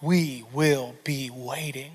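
slow, low, ominous threat; menacing villain promise, dragged out; mock menace. Dad vowing revenge on the garage mice, mock sinister.

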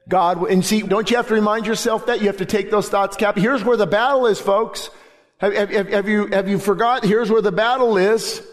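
high, ringing preacher's cry; impassioned preaching; preaching at full force. Acted. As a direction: lift the scripture up with fervor. Preaching climax, high and loud.

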